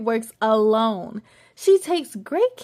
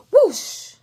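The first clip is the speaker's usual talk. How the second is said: whoosh sound; 8 semitones higher, 6 dB louder than usual. Acted. Sound effect of being pulled.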